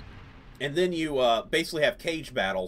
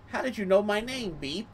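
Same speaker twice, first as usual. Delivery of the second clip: a touch higher, quizzical little bug voice; lightly voiced bug; 7 semitones higher than usual. Beep the bug asking the player how he knew, lightly voiced. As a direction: play a curious small alien. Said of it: "And he's like".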